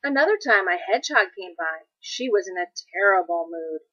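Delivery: ordinary delivery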